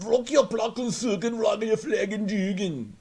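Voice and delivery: put-on high, slow garbled European-accent gibberish; mock European accent. European rep tries to help a caller, exaggerated foreign accent. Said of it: "They could be German or French".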